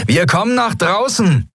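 high-pitched call to people outside. A narrator's reading. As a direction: call it out high, as if across a distance. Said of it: "rief Sephiron den Yadi-Piraten zu".

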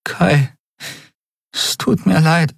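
high, halting, tearful apology; heavy-tongued apology. A narrator's reading. Heroine apologises to Kai through tears, heavy-tongued. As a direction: struggle to get the apology out, pitched high. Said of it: "brachte sie mit schwerer Zunge hervor".

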